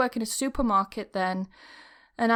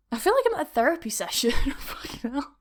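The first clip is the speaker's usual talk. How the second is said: embarrassed laugh through the words; half-laughing, self-conscious aside; 7 semitones higher, faster than usual. Real, not acted. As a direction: laugh at yourself as you say it. Laughing at herself.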